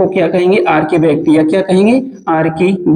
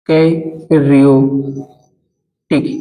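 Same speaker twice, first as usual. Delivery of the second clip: Karyotic split up with long gaps; karyotic drawn out at writing speed; slow dictation; 4 semitones lower, much slower than usual. Shown as a demonstration. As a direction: dictate karyotic very slowly, syllable by syllable. Teacher dictating term for notes.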